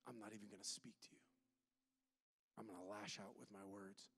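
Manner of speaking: whispered, low and cold; hissed whisper. Pretend. Preacher voicing an angry believer turning on God, whispered. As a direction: hiss it like a grudge.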